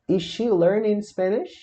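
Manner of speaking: "Is she learning Spanish" slow, high, rising question; interrogative intonation. Shown as a demonstration. Teacher showing how intonation changes, "Is she learning Spanish" as rising question. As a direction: lift the tune up through "Spanish". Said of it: "Veja como a entonação muda".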